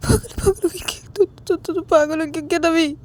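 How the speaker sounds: high, fast, tearful panic; panicked cry. Acted. Rahul, scared after the gunshot, cries. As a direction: blurt it out in frightened tears.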